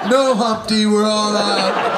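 hammed-up sneering clerk; mock clerk. Clerk teasing the customer called Humpty, slow and loud.

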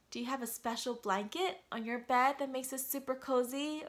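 usual speaking voice

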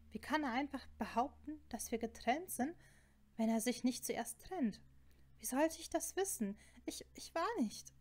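flustered, hurt protest; upset disbelief. Acted. Acted upset muttering after quarrel.

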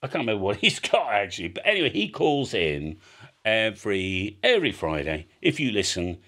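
normal talking voice

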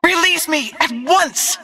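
high-pitched cries of outrage; alarmed shouting. Acted. Grabbed fighter demanding release, high frantic shouting. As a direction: yell to be freed, pitch far up.

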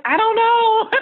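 high, helpless, half-laughing; squeaky bewildered "I don't know". Retold or imitated. Telling a friend how lost she felt, high and bewildered. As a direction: pitch it way up, lost and confused. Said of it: "I'm like".

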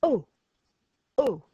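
French O as a shocked gasp. Shown as a demonstration. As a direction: say Oh like a shocked lady. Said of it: "you put your hands to your cheeks and you go".

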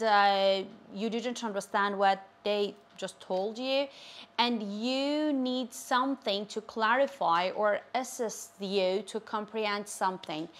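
ordinary delivery

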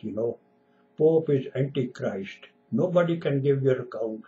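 ordinary talk, nothing marked

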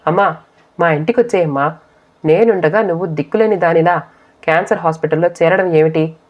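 plain reading voice

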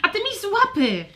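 high, loud snappy comeback; snippy dragon retort. Acted. Trelka firing back at her brother, high and sharp. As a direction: snap it back at him.

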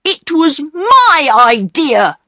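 put-on high, slow, cross boy claiming the blame; angry insistence. A narrator's reading. Peter claiming the idea from his sisters, angry insistence. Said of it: "said Peter angrily".

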